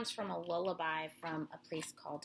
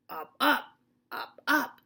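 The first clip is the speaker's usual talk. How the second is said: louder punchy up up; frog croak; 4 semitones higher, 10 dB louder than usual. Acted. Reading to children, loud frog calls.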